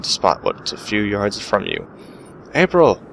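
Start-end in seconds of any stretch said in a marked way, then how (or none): 2.5-3.0 high, loud searching call. Acted. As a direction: shout for a lost companion in a storm. Calling out for April in snowstorm.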